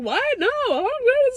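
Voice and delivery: high disbelieving squeal; shocked. Retold or imitated. Friend reacting to covid news, retold with a high squeal.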